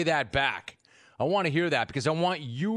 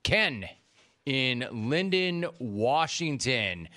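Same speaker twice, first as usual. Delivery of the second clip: ordinary delivery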